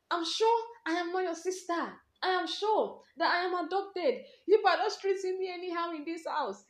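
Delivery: high, loud tearful outburst; crying outburst. Retold or imitated. Young girl yelling at her older sister that she must be adopted, high and loud. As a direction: hurl it at her through tears. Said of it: "I was like".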